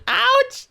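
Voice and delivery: high, loud yelp of pain; sudden acted yelp; pained ouch. Narrator sitting on a scabbard, pained yelp.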